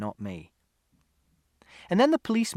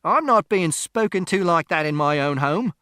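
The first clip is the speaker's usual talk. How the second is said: loud, raised-pitch protest; indignant shout; 5 semitones higher, 7 dB louder than usual. A narrator's reading. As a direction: shout back, affronted in your own home. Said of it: "Mister Shears shouted".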